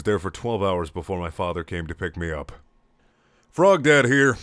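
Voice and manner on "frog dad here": loud announcer callback; dramatic title. Acted. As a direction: boom it like a title card.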